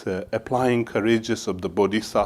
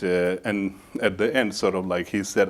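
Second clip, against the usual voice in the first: normal talking voice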